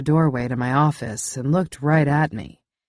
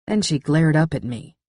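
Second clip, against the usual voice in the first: ordinary delivery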